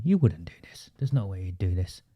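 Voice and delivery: normal talking voice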